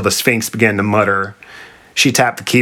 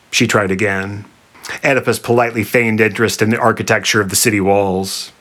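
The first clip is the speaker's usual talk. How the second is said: plain reading voice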